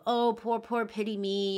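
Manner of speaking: mock yourself wallowing in pity; mock self-pity. Rejecting the self-pity view of anxiety, mocking whiny voice. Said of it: "not from a place of weakness".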